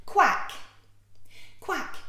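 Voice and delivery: loud high quacking duck; playful duck call. Acted. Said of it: "the captain, he said".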